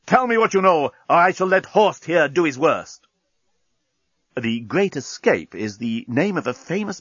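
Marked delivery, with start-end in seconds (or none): 0.0-3.1 threatening. A narrator's reading. Captain threatens prisoner.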